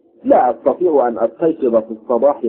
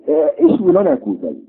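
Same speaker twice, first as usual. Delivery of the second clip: calling out. Acted. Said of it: "البرنس مناديا البائع".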